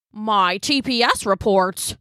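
slow dismayed office worker; businessman. A narrator's reading. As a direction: groan over ruined reports. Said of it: "said a businessman".

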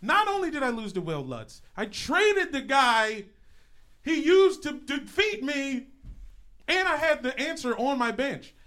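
high, loud, exasperated shouting; passionate, worked-up yelling; fiery rant. Real, not acted. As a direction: rant in outrage at your own bad luck. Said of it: "Fiery, passionate beginning to the show".

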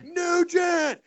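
very high drawn-out crowd yell; cheering troops. Retold or imitated. Troops cheering as Nugent draws his pistol, wild. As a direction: imitate a crowd yelling a name. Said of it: "They're like".

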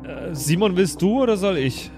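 usual speaking voice

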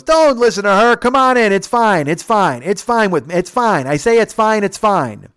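high, loud imitation of a pushy drunk; drunken insisting. Bubba overruling the security guard, loud drunken insisting. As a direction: keep insisting loudly, easing off at the end.

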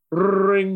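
ringing phone sound effect; imitated phone ring. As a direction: trill it like a telephone bell.

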